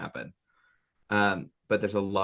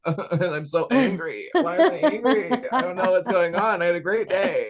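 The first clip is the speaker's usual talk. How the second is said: higher and louder, laughing through the words; 9 semitones higher, 6 dB louder than usual. Real, not acted. Telling the host about mood swings, laughing. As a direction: giggle while you tell it.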